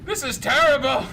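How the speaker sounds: loud, high wail breaking into laughter; laughing mock outrage. Joining the jeans complaint, laughing mock outrage. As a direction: cry out in amused mock despair.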